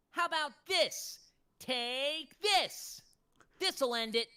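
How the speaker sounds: high, loud, yelling attack lines; Silver voice. Pretend. Voice actor yelling Silver's attack lines on request, high and loud. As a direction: belt out video game battle cries. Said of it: "do you think both of you can do Silver and Blaze's voice".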